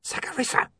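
hissed urgently under the breath; urgent whisper. A narrator's reading. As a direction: whisper her name, urgent warning. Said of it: "hissed William urgently".

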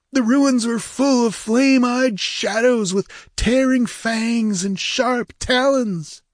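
high-pitched, fearful report; whining pirate. A narrator's reading. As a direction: whine out the horror. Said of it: "whined a red-sashed Zamorian".

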